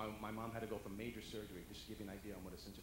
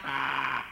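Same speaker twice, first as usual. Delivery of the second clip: loud frantic squawk; 2 semitones higher, 15 dB louder than usual. Retold or imitated. Mom overheating, squawk.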